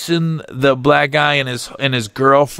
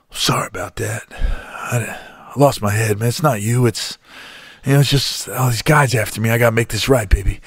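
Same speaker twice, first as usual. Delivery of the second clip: hushed apology; 4 semitones lower than usual. Retold or imitated. Soft apology after the yell.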